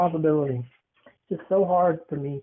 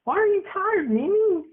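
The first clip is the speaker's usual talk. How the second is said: repeated much higher, cooing; high sing-song. Real, not acted. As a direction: coo the question up high.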